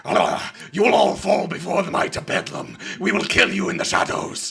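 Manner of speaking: menacing bird creature voice. Acted. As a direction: threaten them as a cultist bird. GM voices Aarakocra villain.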